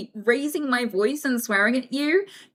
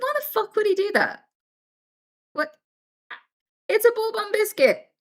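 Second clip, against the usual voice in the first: high-pitched mock disbelief; baffled, pitched well up; can't believe anyone would ask; incredulous; 10 semitones higher than usual. Imagined biscuit tester's disbelief.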